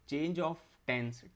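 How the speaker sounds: drawn out word by word; very slow dictation. Shown as a demonstration. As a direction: stretch each word for note-taking. Teacher dictating syllabus term.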